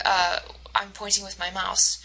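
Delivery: ordinary delivery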